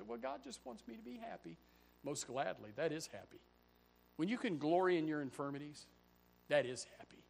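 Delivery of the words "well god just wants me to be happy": mock quote. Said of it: "People say".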